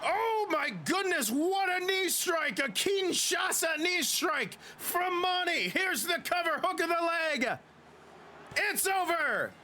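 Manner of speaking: excited high shouting match call; wrestling commentator. Acted. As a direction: scream the finish like a ringside announcer. Match-winning finish called.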